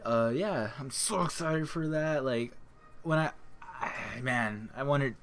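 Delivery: normal talking voice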